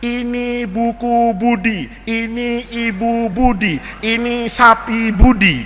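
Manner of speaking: slow syllable-by-syllable recital; first-grade reading. Acted. First grader reading a primer, slow sing-song recital. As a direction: read slowly like a beginning reader. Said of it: "Saya dulu gitu waktu satu".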